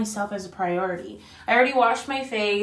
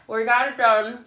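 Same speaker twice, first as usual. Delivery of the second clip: ordinary delivery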